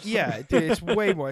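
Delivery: ordinary delivery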